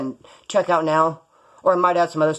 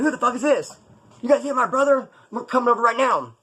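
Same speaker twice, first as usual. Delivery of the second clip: higher, tough-guy phone threat; mock threat; 7 semitones higher than usual. Brother picks up the phone and threatens the people, mock tough. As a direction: play the brother threatening the caller.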